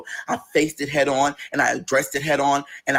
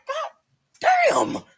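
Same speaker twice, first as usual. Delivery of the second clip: shocked exclamation; 13 semitones higher than usual. Retold or imitated. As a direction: react like you can't believe the place. Shocked at dirty tow lot.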